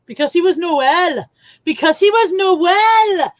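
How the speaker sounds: loud, high, lilting Welsh; Welsh accent. Acted. Streamer attempting Scots for a punchline, lands in loud Welsh. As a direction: belt it out in a put-on Welsh accent. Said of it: "I've gone a bit Welsh".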